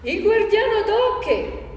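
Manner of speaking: high, slow, ringing shout; peasant's bold cry, pitched high; loud, clear yell. Acted. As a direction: yell the name out, stretching each word. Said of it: "urlò forte e chiaro".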